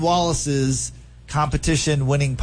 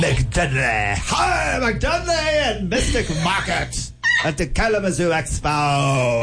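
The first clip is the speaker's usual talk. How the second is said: pirate voice; 5 semitones higher, slower than usual. Pretend. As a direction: bellow it like a pirate. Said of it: "I sound like a pirate".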